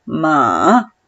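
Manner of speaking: MA dipping low, then rising; third tone. Shown as a demonstration. Teacher showing third tone to student, MA low dipping. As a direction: fall into a well on MA. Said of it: "como si te cayeras a un pozo".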